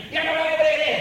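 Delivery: loud, emphatic no, no, no; loud refusal. Retold or imitated. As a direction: shout them down, refusing. Israeli refusing his phrase.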